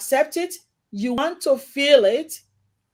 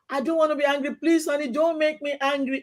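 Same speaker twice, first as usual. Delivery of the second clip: begging husband; 5 semitones higher than usual. Retold or imitated. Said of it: "I begged".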